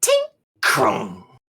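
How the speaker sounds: small tink, then heavy crash; vocal clink and clang effects; imitated metal sounds. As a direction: voice the pin popping and the metal clanging down. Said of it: "There's a tink".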